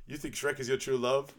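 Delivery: retold double-check, not yet shouting; incredulous question. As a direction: ask it in controlled disbelief. First take, incredulous question.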